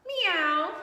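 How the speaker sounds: high mock meow; playful cat noise. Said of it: "said Cat".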